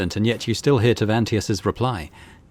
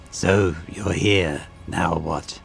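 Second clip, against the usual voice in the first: lower, slow and contemptuous; bored arrogant xenos; 3 semitones lower, slower than usual. Acted. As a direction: sneer at him from above, lower than your own voice. Tavantius continues, bored and scornful.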